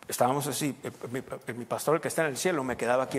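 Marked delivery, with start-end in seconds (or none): none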